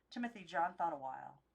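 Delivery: usual speaking voice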